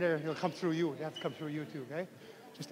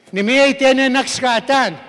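loud, higher model phrase; loud call-out; 5 semitones higher, 8 dB louder than usual. Shown as a demonstration. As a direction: project it to the whole class. Teacher calling out phrase to class.

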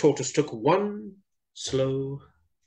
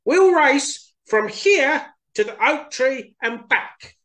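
high, slow, eager hare voice; can't wait to start; excited Hare; 12 semitones higher, 5 dB louder, slower than usual. Acted. Hare eager to race Tortoise, excited and high. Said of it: "said Hare excitedly".

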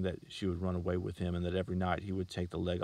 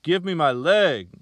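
slow, high, much louder screech; 10 semitones higher, 15 dB louder, slower than usual. A narrator's reading. Girl screeching at the salesman for her leg, slow and loud. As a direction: shriek for the leg. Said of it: "she screeched".

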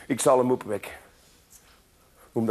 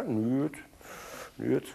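tiny murmured no; 5 semitones lower, 10 dB quieter than usual. Acted. Child answers, tiny voice.

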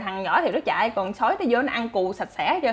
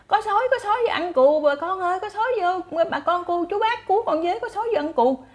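crying wolf; 9 semitones higher than usual. Acted. Shepherd boy fakes a wolf alarm to villagers, high shout. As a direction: yell for the villagers like a prankster boy. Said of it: "Tự nhiên nó la lên".

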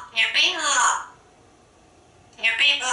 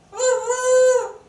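very high, drawn-out woo-hoo; high cheering call; excited whoop; 12 semitones higher, 4 dB louder than usual. Real, not acted. Cheering after hop aboard.

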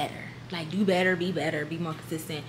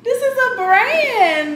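excited squeal; 14 semitones higher, 7 dB louder, slower than usual. Real, not acted. Unboxing a PR package, thrilled.

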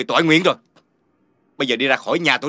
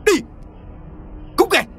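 angry shout. Acted. Angry man drives visitors out.

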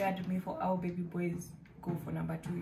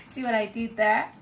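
laughing through a proud pun, louder; 4 semitones higher, 4 dB louder than usual. Real, not acted. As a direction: giggle it out, pleased with the joke. Pun on Musafi and usafi.

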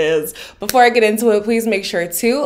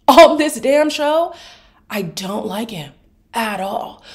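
ordinary delivery

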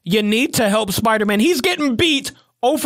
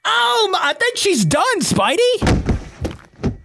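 shocked; 9 semitones higher, much slower than usual. Real, not acted. Shocked at Granny's defeat.

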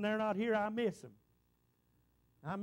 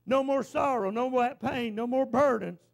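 preaching; 6 dB louder, slower than usual. Real, not acted. Preacher's climactic list.

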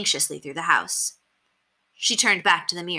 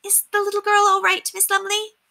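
very high, squeaky servant voice; squeaky housemaid, concerned. A narrator's reading. Housemaid asking governess about the girl, squeaky concern. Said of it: "with her high voice squeaky as a fiddle".

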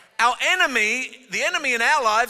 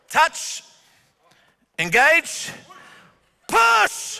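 louder, rising calls ending in a high push; called scrum commands; 7 semitones higher, 5 dB louder, much slower than usual. Shown as a demonstration. Coach calling touch engage push, loud. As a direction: call each command like a referee.

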